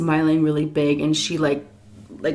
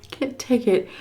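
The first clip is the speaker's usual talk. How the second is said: delighted gasp; 6 semitones higher, 3 dB quieter than usual. Real, not acted. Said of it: "food reaction".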